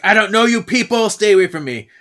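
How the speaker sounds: high, loud push-away shout; frightened shout. Retold or imitated. Disoriented Coop yells at Piper and Paige, loud and high. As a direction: yell to keep strangers back.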